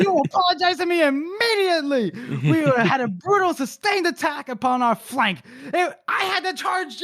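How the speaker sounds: high, indignant rant; mock outrage. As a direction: rant like an offended general. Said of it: "he's like".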